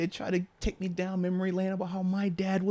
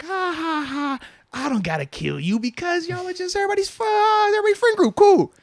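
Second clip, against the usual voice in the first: high, rising, louder mock-jolly dad; cocky dad; 12 semitones higher, 5 dB louder than usual. Dad imitating his relaxed self last year. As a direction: laugh it off, getting louder to the end. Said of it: "Last year was kinda like".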